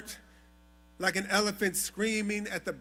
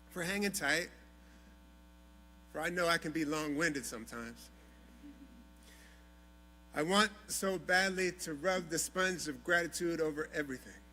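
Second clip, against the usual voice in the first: soft, lower, intimate closing; hushed; 8 semitones lower, 9 dB quieter than usual. Acted. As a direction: bring it down to a near murmur. Closing of gratitude poem, intimate.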